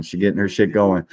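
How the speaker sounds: usual speaking voice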